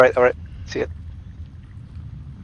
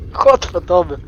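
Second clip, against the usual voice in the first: ordinary delivery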